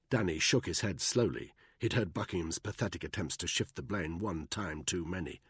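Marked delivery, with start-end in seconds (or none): none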